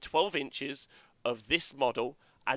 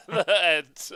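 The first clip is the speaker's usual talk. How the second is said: laughing; 3 dB louder than usual. Acted.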